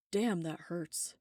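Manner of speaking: a hurting man forcing it out slowly; pained. Acted. Michael in pain, eyes streaming.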